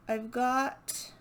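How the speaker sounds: ordinary delivery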